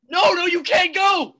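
frantic loud refusal; panicked shout as she turns to go; loud desperate plea. Acted. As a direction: beg her loudly not to leave. Panicked man stops friend.

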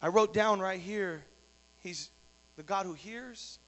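ordinary delivery

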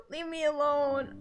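normal talking voice

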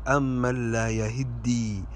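"يهدي" slowly as yahiddi; slow "يهدي", i after ha, doubled d; doubled dal. Shown as a demonstration. Teacher gives the correction, "يهدي" slowly as yahiddi. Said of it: "الدال مشددة".

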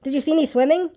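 high, eager little voice; high squeaky showing-off; excited squeak. A narrator's reading. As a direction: squeak it high, begging to be admired. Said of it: "squeaked Roo excitedly".

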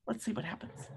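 soft suspenseful whisper. Real, not acted. Teacher whispering to the children before the page turn. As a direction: hush it, let them lean in. Storyteller building suspense for kids.

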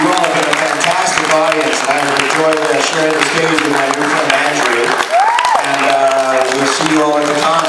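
loud upbeat stage announcer thanks; host's loud closing; emcee sign-off. Real, not acted. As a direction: wrap up the show for the crowd.

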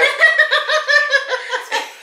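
high laugh. Real, not acted. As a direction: laugh out in delight. Laughing at juicy pear.

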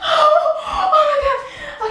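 very high, drawn-out loud wail; panicked wail. Real, not acted. Midway through cutting own hair, panicked wail.

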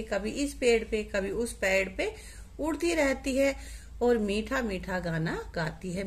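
ordinary delivery

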